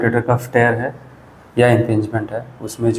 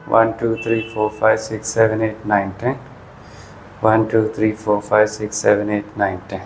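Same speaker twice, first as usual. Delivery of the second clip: exercise count; 2 semitones lower, 5 dB quieter than usual. Shown as a demonstration. Physio counting viewers through an isometric wall push, steady count. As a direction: count the hold out evenly, like a physio.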